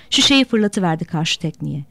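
ordinary delivery, nothing marked

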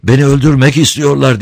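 high, loud, frightened plea; panicked cry. Acted. Fleeing man begging the priest for help, loud and terrified. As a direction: gasp it out as if the mob is at your heels. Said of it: "yüzü korkudan allak bullak, bitkin, soluk soluğa".

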